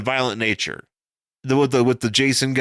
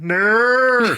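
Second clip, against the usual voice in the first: higher mock-Australian announcement and "ner"; badly faked Australian, ending on a stretched cry; bad Australian accent; 13 semitones higher, 4 dB louder than usual. Demonstrating Mister Wayne as an Australian, bad Australian accent. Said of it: "here's Mister Wayne as an Australian".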